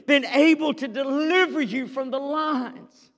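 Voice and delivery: high, loud anguished calling out to Daniel; lamenting cry. Acted. King calls into the lions' den, lamenting cry. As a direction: cry out in anguish, rising to a shout on has your God. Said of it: "he cried out with a lamenting voice".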